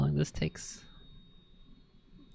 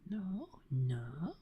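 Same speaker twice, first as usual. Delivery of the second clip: ordinary delivery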